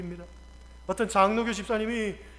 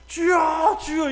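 raised, higher-pitched shout; intended prayer cry; 6 semitones higher, 6 dB louder, much slower than usual. Acted. Dreamer wanting to call the Lord, raised shout. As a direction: call out as if demonstrating the proper cry. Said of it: "이걸 해야 되는데".

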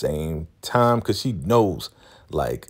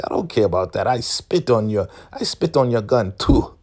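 higher, louder mock defiance; defiant; 6 semitones higher, 4 dB louder than usual. Marva defying Malcolm's gun, defiant. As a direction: spit the words at him. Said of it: "she's like".

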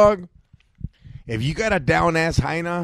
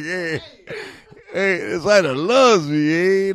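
slow, louder, laughing through the words; 4 semitones higher, 4 dB louder, slower than usual. Retold or imitated. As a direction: crack up while saying it, drawn out. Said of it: "I was like".

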